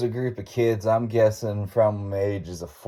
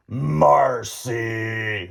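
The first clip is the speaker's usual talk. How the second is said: drawn-out mock ghostly call; long, put-on ghost voice; deep spirit voice. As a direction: play a spooky spirit calling out a name. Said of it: "like, the deepest whatever".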